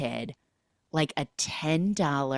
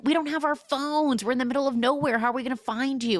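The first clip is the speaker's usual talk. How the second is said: mock panic; 9 semitones higher than usual. Retold protest of stranded friends.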